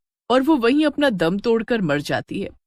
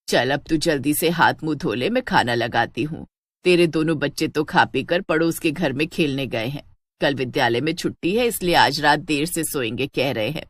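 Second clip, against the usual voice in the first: put-on lower, mellow voice of an elderly mother-in-law; old mother-in-law; 5 semitones lower than usual. Elderly mother-in-law pleased with Sheila's news, lower voice. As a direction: speak low and fond, like an old mother praising her daughter-in-law.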